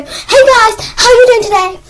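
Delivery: loud, high, over-the-top video greeting; exaggerated shouted vlogger intro; giant YouTuber. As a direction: blast it out like a hyped-up YouTuber. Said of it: "the giant YouTuber that would go".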